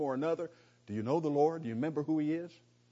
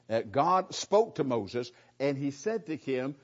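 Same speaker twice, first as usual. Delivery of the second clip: ordinary talk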